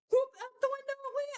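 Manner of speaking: high, loud and quick, mocking the toss; hammed-up, fast, high exclamation; mock whoop. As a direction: fling it out like a sing-song joke. Mocking the tossed bag.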